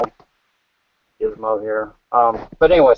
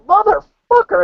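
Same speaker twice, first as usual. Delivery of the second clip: retold curse, pitched high; 11 semitones higher than usual. Retold road rage curse.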